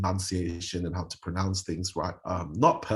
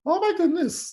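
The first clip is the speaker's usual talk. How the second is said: high-pitched amazed exclaim; amazed gasp; 6 dB louder than usual. Retold or imitated. Onlookers seeing his finished makeup, high and amazed. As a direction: squeal it out in delight. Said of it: "everybody was like".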